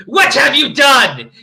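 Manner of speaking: slow, loud, high-pitched bellow; angry shout. A narrator's reading. Dumbledore storming in on the band, angry shouting. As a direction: thunder it out as an accusation. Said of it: "walked in angrily".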